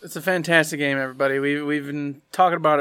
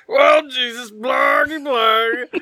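higher, nonsense sounds blurted in a panic; flustered gibberish; 5 semitones higher, slower than usual. Retold or imitated. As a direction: sputter like your brain just short-circuited. Acting out flustered game panic.

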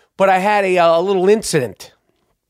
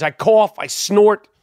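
ordinary delivery

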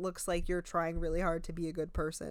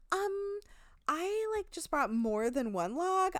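high, hesitant airhead voice; ditzy high voice; 10 semitones higher than usual. Retold or imitated. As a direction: play the clueless girl, pitched up. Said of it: "And Shatar goes".

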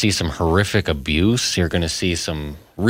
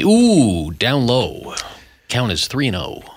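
excited higher pitch call; baseball announcer; 4 semitones higher, slower than usual. Pretend. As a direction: call the count on air. Mock baseball play-by-play.

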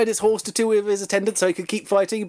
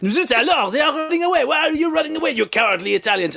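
mock French accent; 10 semitones higher than usual. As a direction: play a huffy French commander in a cartoon French accent. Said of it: "And the French went".

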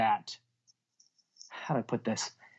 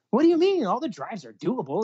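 baffled protest; 8 semitones higher than usual. Retold or imitated. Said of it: "I'm like".

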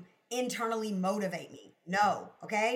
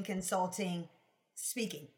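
ordinary talk

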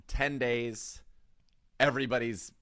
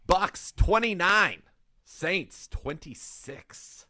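slow, drawn-out score call, first half punched louder; dramatic pick reveal; 6 semitones higher, 4 dB louder, much slower than usual. Acted. Host to co-hosts, announcing his final score pick, slow and dramatic. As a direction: build suspense like revealing a verdict.

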